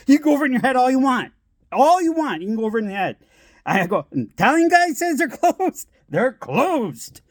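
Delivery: high-pitched mock exasperation; exasperated husband. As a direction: imitate a fed-up husband insisting, half laughing. Exasperated dad argues with mom.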